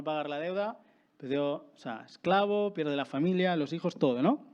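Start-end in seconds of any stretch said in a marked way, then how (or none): none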